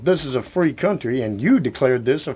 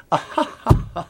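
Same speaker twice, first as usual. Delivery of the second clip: sinister chuckle; 4 semitones higher than usual. Acted. Villain laughing.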